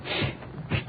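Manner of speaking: unvoiced urgent shushing; whispered shh while hiding; hushing. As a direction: hush your friend so you're not found. Hiding from pursuer.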